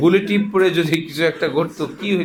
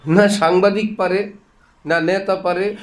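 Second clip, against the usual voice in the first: ordinary delivery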